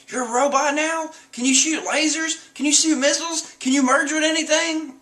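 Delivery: very high, squeaky eager questions; a childlike cartoon reindeer voice; excited Chopper. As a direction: pepper him with questions like an excited kid. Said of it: "it's funny that Chopper's doing it".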